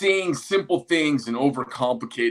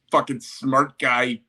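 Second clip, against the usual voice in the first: normal talking voice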